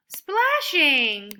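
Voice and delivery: Splashing with a delighted gasp; excited discovery. Shown as a demonstration. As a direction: act out finding the answer.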